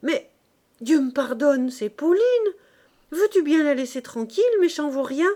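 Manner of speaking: put-on high, loud, sharp-tongued old maid; indignant outcry, pitched high and fast; sour, scolding cry. A narrator's reading. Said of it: "lorsqu'une voix aigre, la voix de mademoiselle Saget, s'écria".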